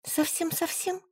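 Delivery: plead, crestfallen; upset. A narrator's reading. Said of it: "расстроилась я".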